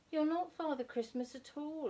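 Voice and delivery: put-on high, mildly cross child's complaint; a touch of sulky indignation; crossly. A narrator's reading. Plop scolds the lady for not being Father Christmas, mildly cross. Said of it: "he said crossly".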